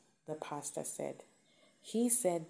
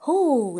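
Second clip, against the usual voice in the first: sharp rising whoo, much louder; 9 semitones higher, 16 dB louder than usual. Real, not acted. Vlogger bracing herself before the male perspective, loud whoo. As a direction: blow out a loud whoo before a heavy topic.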